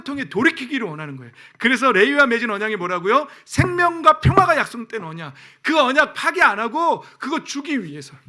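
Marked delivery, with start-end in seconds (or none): 1.6-8.2 loud, high, impassioned preaching that slows at the end; full-force preaching. Acted. Pastor to congregation on the covenant, fiery preaching. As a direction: drive the covenant home at full volume.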